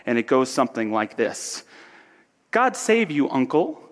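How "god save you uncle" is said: high, cheerful greeting to an uncle. Acted. Nephew greeting his uncle Scrooge at Christmas, cheerful. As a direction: call out a cheery hello, pitched up. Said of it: "cried a cheerful voice".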